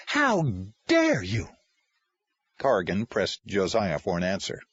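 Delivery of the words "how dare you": angrier outrage. Acted. Caseworker repeats her accusation, higher and angrier. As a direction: escalate the accusation, leaning on HOW.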